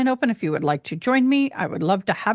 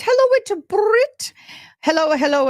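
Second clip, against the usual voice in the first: excited greeting; 11 semitones higher, 4 dB louder than usual. Real, not acted. Streamer spotting a viewer's comment, high gushing greeting. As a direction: sing out a big welcome.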